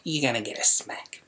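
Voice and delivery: hushed, menacing whisper; a soft whispered warning to the naughty child; whispered threat. Retold or imitated. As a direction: breathe the threat, voice dropped right down. Threatening daughter in retold story.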